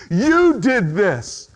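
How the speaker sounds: very slow, high accusation, YOU drawn out; angry blame. Acted. Preacher acting out anger at someone else, accusingly. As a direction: point the finger with a long "You". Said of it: "You're mad at someone else".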